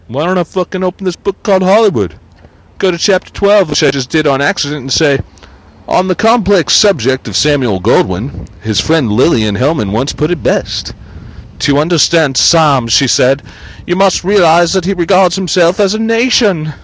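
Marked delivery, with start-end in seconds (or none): 0.1-3.7 higher, louder put-on voice; hammed-up voice of himself; mock self-quote. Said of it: "So what I should have said was". 5.9-10.9 higher, louder reading voice with a put-on timbre; performed reading. Shown as a demonstration. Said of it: "So what I should have said was". 11.6-16.7 higher, louder narrator voice; audiobook read. Shown as a demonstration. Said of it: "So what I should have said was".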